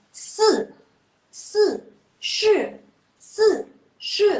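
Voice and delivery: si and shi high and loud, falling sharply; fourth-tone drill. Shown as a demonstration. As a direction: drive each syllable down hard. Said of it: "coba deh pakai bantuan tangan".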